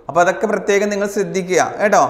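ordinary talk

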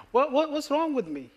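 high-pitched, stammered mock dismay; retold bewildered question to oneself; self-questioning. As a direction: pitch it up, baffled at yourself. Retelling question asked at the mirror.

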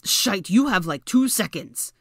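loud, strained, slowing warning; loud frantic warning; urgent warning. A narrator's reading. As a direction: warn her there's no time. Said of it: "Draco warned".

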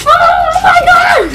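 slow high startled shout; shocked shout. Real, not acted. Failed knee bounce.

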